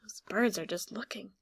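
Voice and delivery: ordinary talk, nothing marked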